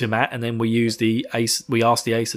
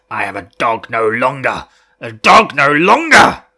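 growing rage; 8 dB louder than usual. Acted. Fane rages that he is a dog no longer, building to a roar. Villain raging at the night.

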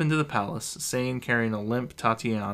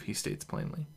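usual speaking voice

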